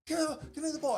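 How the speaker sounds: high, frustrated mock plea; irritated. Malik Monk jumping in the corner, calling for the ball, irritated. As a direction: imitate an irritated player pleading in a very high voice. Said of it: "like, irritated in that moment".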